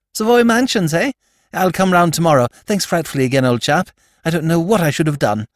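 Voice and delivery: put-on high, eager young toff; lord Drever voice. Young lord approaching Jimmy's table, high eager toff voice. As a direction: pitch it up like a flustered young aristocrat.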